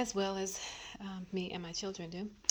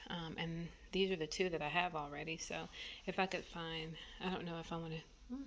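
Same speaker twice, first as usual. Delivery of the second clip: ordinary talk, nothing marked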